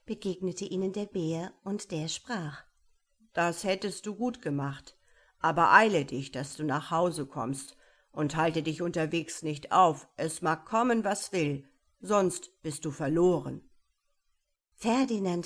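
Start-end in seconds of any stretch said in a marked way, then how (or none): 3.3-13.7 lauter, eindringlicher Bär, der warnt; mahnender Bär. Acted. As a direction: streng mahnen wie ein Bär. (eindringliche, laute Bärenwarnung)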